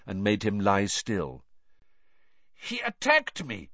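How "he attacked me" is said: put-on high, accented complaint; muttering Krum. A narrator's reading. Krum coming round after the attack, accented complaint. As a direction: Eastern European accent, pitched well up. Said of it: "Krum muttered".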